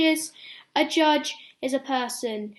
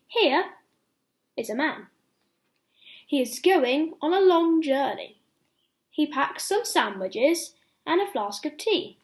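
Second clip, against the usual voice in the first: lively read-aloud for little ones; bedtime story reading; 3 semitones higher, 3 dB louder than usual. Acted. Ben reading a bedtime story to children, lively storytelling. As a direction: tell it like a picture book at bedtime.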